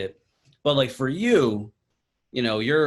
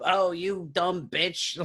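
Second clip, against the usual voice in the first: slow, higher, drawn-out sneer; slow mocking put-down; mock insult; 7 semitones higher, much slower than usual. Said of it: "they're like".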